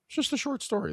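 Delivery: the same line jumped way up in pitch, softly. Shown as a demonstration. Repeating the title to the viewers, sung out high. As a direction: say it again in a high, light voice.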